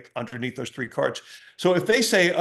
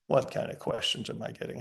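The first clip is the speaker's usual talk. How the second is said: muttered aside; 4 semitones lower, 7 dB quieter than usual. Real, not acted. Mock complaint about goofy questions.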